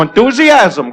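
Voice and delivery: ordinary delivery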